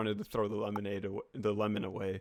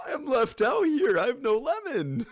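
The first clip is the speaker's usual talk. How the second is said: whiny complaint; 13 semitones higher, 5 dB louder than usual. Retold or imitated. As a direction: whine like a sulking kid with no lemon. Left out, whining about lemon.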